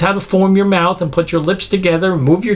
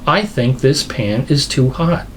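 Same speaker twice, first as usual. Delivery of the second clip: unhurried, measured mock reasoning; 2 semitones lower than usual. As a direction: think it through step by step, far too calmly. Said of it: "if you grab that and you go".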